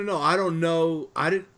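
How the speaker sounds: normal talking voice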